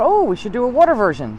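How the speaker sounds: an eureka moment, voice leaping high; excited idea. Retold or imitated. Guide recalling her idea for water tours, excitedly.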